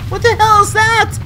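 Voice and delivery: high loud alarmed cry. Real, not acted. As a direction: shriek in alarm.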